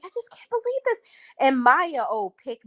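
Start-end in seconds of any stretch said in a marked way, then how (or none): 0.0-1.0 high, mock-dramatic whine; mocking a reality star's fake shock; mocking Lexi. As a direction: mimic her fake disbelief, pitched way up. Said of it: "and she's like".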